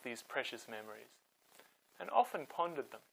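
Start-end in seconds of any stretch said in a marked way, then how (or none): none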